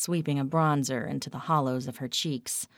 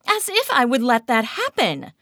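loud, higher indignation; indignant; 9 semitones higher, 8 dB louder than usual. A narrator's reading. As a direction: fling it out offended. Kat indignant.

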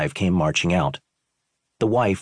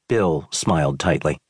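ordinary talk

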